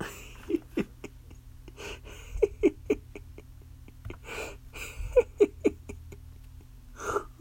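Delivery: long, high, wheezing cackle; a long, high giggle fit; high squealing laugh. Acted. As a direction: shriek with helpless laughter. Cracking up about Uncle Kenny.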